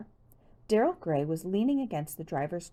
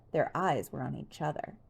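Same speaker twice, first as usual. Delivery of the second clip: ordinary delivery, nothing marked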